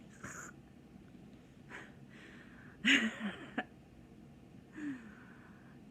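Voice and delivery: breathy giggle; laughing. Real, not acted. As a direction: laugh at the memory. Laughing at memory.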